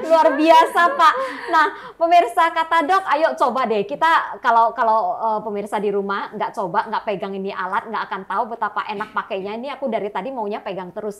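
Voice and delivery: loud, eager sales pitch; infomercial. Acted. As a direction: sell it to the viewers at home. Host pitches product to viewers. (promosi penuh semangat ala iklan TV)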